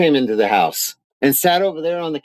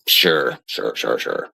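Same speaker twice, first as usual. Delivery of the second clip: sarcastic sure; 4 dB quieter, slower than usual. Real, not acted. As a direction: lower and fading away, dismissive. Sarcastic dismissal of claim.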